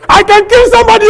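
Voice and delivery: high, loud shout of I can kill somebody; shouted threat. Retold or imitated. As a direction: bellow the threat. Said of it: "I say".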